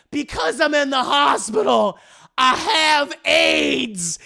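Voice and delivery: loud, slow, crying out; crying confession. Retold or imitated. Dramatic confession in the story.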